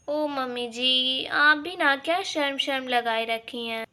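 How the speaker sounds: normal talking voice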